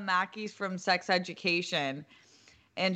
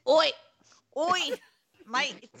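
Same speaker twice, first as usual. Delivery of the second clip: mock British accent; 8 semitones higher, 4 dB louder than usual. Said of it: "we do our British accent".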